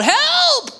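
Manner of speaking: high, loud panicked cry; cry for help. Retold or imitated. Man in gorilla suit facing the lion, high loud cry for help. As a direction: yell for help in a comic panic. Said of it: "he cries out".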